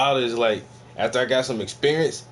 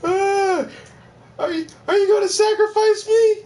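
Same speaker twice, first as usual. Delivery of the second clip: high, loud falsetto squeal of a frightened victim; mock scared victim; 4 dB louder than usual. Vlogger voicing the vampire's victim, hammed-up high squeal. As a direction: squeal like a hammed-up terrified maiden.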